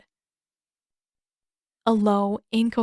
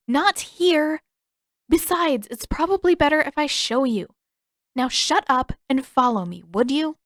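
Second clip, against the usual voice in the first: put-on higher, louder bossy girl; a lightly voiced bossy Katara; 6 semitones higher, 4 dB louder than usual. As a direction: order them along impatiently.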